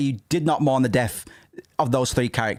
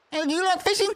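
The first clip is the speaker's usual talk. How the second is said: cheery NPC greeting. Acted. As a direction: call out brightly like an overeager villager. NPC voice again.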